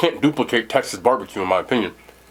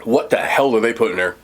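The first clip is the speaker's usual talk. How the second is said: normal talking voice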